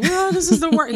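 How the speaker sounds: high-pitched mock wail; mock crying. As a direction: play up a weepy complaint.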